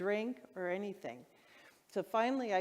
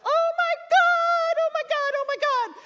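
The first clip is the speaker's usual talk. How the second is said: excited shrieking; 13 dB louder than usual. Retold or imitated. Cat owner discovering five new kittens, excited shrieking. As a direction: scream it out, overjoyed. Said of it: "I was so excited".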